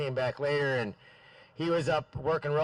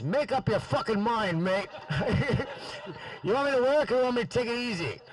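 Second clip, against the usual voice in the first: imitating Jack; 9 semitones higher than usual. Jack's retort, retold with laughter.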